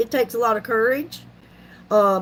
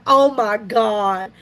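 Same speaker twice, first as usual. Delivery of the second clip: slow, drawn-out mortified groan of words; understated mock dismay, slowly; imitated embarrassment; 3 semitones lower, much slower than usual. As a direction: play a mildly embarrassed relative, dragging it out. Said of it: "my daughter-in-law kinda got embarrassed".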